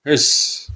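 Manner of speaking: voiceless, drawn-out hiss; imitated fiend hiss. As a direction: hiss like a menacing creature.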